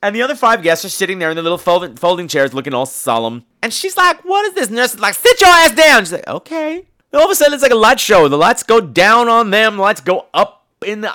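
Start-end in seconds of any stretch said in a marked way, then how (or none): 4.2-4.7 high-pitched mock bewilderment; bewildered. Maggie seeing the strange hospital room, bewildered. 5.1-6.0 high, loud mock command; bossy nurse. Nurse ordering Maggie into her chair, bossy. 6.3-6.9 high, small, soft voice rising; meek compliance. Retold or imitated. Maggie obeying the bossy nurse, meek.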